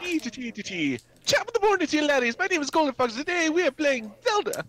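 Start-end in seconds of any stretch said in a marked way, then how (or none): 1.3-2.4 high, mock Irish YouTuber intro; sing-song Irish welcome, way up high; mock Irish greeting. 2.4-4.6 high, hammed-up Irish-style intro; cheery high put-on Irish voice; mock Irish intro.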